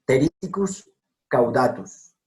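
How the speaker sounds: ordinary talk, nothing marked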